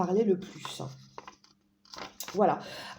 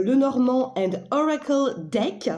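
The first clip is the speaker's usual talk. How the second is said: English title in a thick "Peruvian" accent, slowly; self-styled Peruvian accent; 4 semitones higher, 3 dB louder, slower than usual. Real, not acted. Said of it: "avec mon accent, euh, péruvien".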